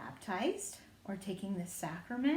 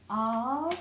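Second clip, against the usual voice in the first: slow and drawn out; spoken slowly for the class; much slower than usual. Shown as a demonstration. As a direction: stretch each word out slowly. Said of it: "We've got".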